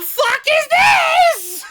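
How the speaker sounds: high, loud, slow disbelieving shout; exploding at maple syrup Pepsi; shocked shout. Real, not acted. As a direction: let it burst out in shock. After stammering, explodes.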